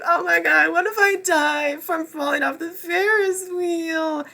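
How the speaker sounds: mock a scared girl clinging to a Ferris wheel, crying; melodramatic mock fear. Reviewer mocking Triss's Ferris wheel fear, hammed-up high whimpering panic. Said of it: "it was so melodramatic with her being like".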